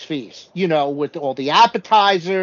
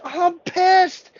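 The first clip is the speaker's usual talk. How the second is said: high mock-angry whine; a hint of a fed-up castaway; imitating Liz fuming; 10 semitones higher, slower than usual. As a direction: replay her exasperation, half-hammed. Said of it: "she's like".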